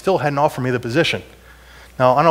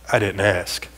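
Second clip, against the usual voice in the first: lower, dry dismissive retort; Joker's curt retort; 7 semitones lower, 3 dB quieter, slower than usual. Retold or imitated. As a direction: brush her off coldly. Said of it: "And he says".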